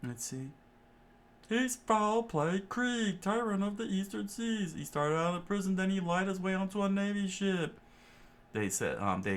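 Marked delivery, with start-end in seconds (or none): 1.5-7.8 high put-on chef voice, steady telling; exposition chef. Chef tells the story of Krieg, high character voice. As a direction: explain the tyrant in a raised character voice.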